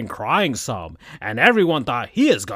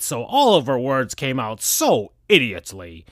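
ordinary delivery, nothing marked

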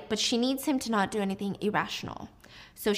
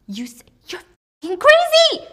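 high, loud outraged shriek; furious scream; 10 dB louder than usual. Acted. Mina shrieking at Diana over the stolen phone, loud and high. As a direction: let it burst out of you, shrieking.

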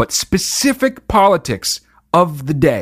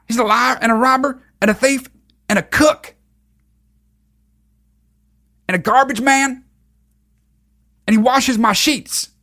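high, hammed-up Southern preacher; mocking Greg Locke; 5 semitones higher than usual. Host extending the preacher's list, mock sermon. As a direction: build the silly list, slowing for the last line. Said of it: "How do people still talk like that?".

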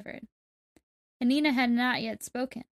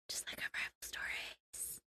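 unhurried, hushed whisper to the co-host; whispered aside; 14 dB quieter than usual. Real, not acted. Whispered aside during the story.

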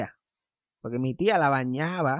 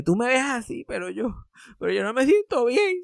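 put-on raised, whiny old-lady voice; mock old lady complaint; 8 semitones higher than usual. Grandma complaining to grandson, higher whiny voice. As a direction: play the grandma fussing that she feels unwell.

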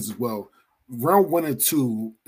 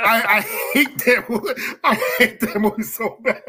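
high, laughing through the words; giggling mock hatred; laughing rant; 8 semitones higher, 5 dB louder than usual. Real, not acted. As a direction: laugh while you trash it. Laughing rant about hated movie.

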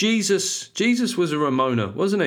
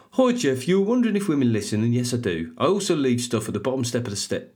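put-on Brummie accent. Host rereading the listener's review, in a Brummie accent. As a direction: start the review again in Brummie. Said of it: "it's gonna be a Brummie accent".

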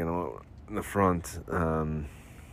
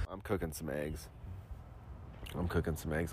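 muttered aside; 4 semitones lower, 16 dB quieter than usual. Real, not acted. Muttering to himself.

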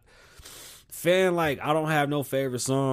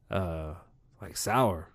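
usual speaking voice

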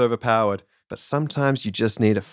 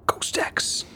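hushed whisper, all breath; soft theatrical whisper; 6 dB quieter than usual. Acted. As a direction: whisper the weapon's name dramatically. Announces the weapon in a hush.

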